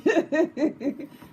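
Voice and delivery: a bright burst of laughter; cackling at own joke. Real, not acted. Cook laughing at her own joke to viewers, loud bright laughter.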